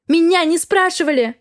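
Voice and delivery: put-on girl cries out to the doctor, desperate shout. A narrator's reading. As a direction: blurt it out in desperation, loud. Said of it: "в отчаянии закричала девушка".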